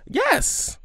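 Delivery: high excited yes; emphatic yes. Real, not acted. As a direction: burst out yes. Emphatic agreement.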